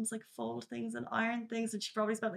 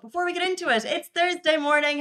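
excited; 8 semitones higher, 11 dB louder than usual. Acted. Hyped morning greeting to co-host.

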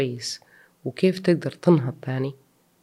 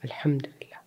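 whispered; 8 dB quieter than usual. Real, not acted. Moved, thanking God.

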